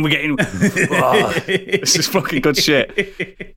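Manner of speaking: laughing through the words; delighted laughter. Real, not acted. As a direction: crack up at your own pitch. Laughing at his own pitch.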